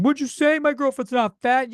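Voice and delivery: high, loud, defensive reality-show contestant; angry contestant. Retold or imitated. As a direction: play a contestant snapping back. Voicing a contestant in a fight.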